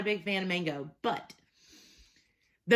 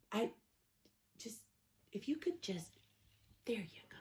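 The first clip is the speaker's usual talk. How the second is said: hushed aside; 12 dB quieter than usual. Real, not acted. Hushed aside to someone off camera.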